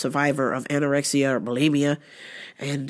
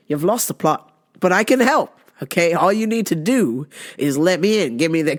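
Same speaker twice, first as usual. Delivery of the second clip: ordinary talk, nothing marked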